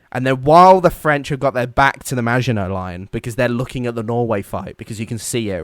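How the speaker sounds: ordinary talk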